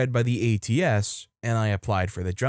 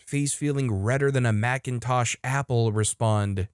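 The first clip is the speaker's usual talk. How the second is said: usual speaking voice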